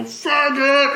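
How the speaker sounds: high, loud drawn-out heckle; sudden high-pitched shout; mock yell. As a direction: yell it like abuse from a passing car.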